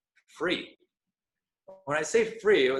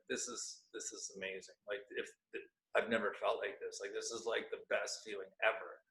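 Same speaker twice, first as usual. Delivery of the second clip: hushed awe; 5 semitones lower, 12 dB quieter than usual. Retold or imitated. Hushed awe while walking with light.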